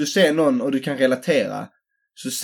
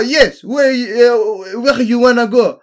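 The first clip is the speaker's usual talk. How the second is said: mock French accent; 6 semitones higher, 4 dB louder, much slower than usual. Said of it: "Han bara".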